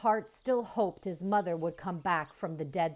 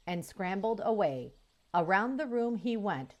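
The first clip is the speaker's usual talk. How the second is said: ordinary delivery, nothing marked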